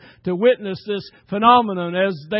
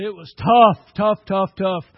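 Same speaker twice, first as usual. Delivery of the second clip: first "tough" shouted much louder, then repeated fading; all caps; 7 dB louder than usual. Real, not acted. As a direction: hammer "tough" like it's written in capitals. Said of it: "all caps".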